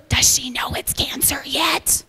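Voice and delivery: a whisper meant to carry across the room; loud whisper. Retold or imitated. Nurse asking a colleague about the patient, loud stage whisper. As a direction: hiss the question to a colleague, unvoiced. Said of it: "she whispers really loud in the room".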